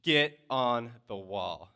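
slow, loud stern-dad order. Retold or imitated. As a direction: order it like a dad, each word drawn out. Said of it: "in, like, now my dad voice".

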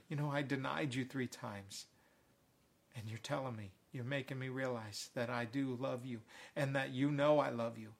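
hushed admission to the one he denied; humbled Peter. Acted. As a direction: answer quietly, as one needing reassurance. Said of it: "I believe Peter had to be reassured".

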